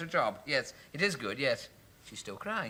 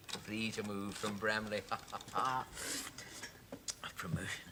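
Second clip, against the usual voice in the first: whispered; 6 semitones lower, 10 dB quieter, slower than usual. Acted. Alone, savouring his promotion.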